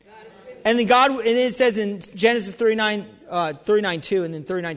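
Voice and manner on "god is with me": breathless whisper, no voice at all; whispered response. Shown as a demonstration. Preacher whispering the repeat for the crowd. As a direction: whisper it back like the congregation.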